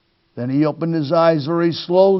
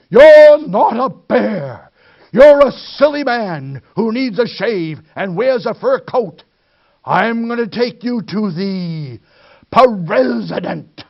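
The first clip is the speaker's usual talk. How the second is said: loud, high, slow bellow; raging yell; 6 semitones higher, 4 dB louder than usual. Acted. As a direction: roar it out in a rage. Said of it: "He yelled in a rage".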